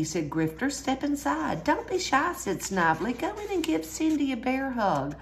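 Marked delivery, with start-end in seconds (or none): 0.7-2.3 high, sly coaxing voice. A narrator's reading. Villains luring Yogi. 3.1-5.1 sly coaxing. A narrator's reading. Villain luring Yogi.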